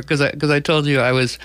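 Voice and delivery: ordinary delivery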